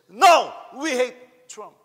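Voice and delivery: high, loud, slow yell; screaming. Retold or imitated. Filipina shouting down the Trump-praising tourists, screaming. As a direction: belt it out slow and defiant. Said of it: "is screaming and say".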